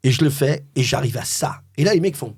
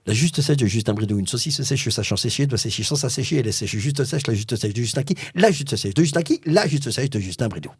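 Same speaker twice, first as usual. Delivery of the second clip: fast, evenly driven patter, punched near the end; rapid-fire tongue twister. Shown as a demonstration. Guest redoing his advert line against the clock, rapid-fire. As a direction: race through the slogan in one breath. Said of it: "La phrase à dire en huit secondes".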